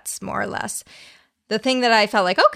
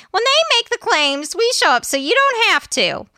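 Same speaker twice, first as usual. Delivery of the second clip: high sassy ghost voice; 12 semitones higher, 4 dB louder than usual. Acted. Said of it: "your ghost voice is like".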